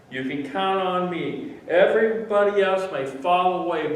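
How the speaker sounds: loud, high, emphatic pledge; fervent vow. Acted. Peter promises Jesus he will never fall away, loud vow.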